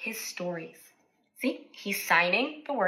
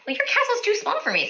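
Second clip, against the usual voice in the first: high, fast, lightly voiced dragon; 9 semitones higher, much faster than usual. Dragon telling the king his castle is too small. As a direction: toss it off like a big dragon. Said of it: "said the dragon".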